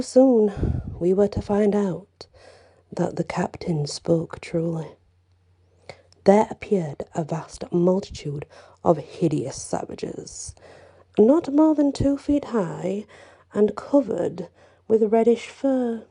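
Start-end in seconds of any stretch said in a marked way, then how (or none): none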